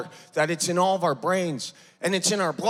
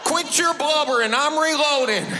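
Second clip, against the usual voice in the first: mocking wife; 7 semitones higher than usual. Wife shooting husband, mocking him.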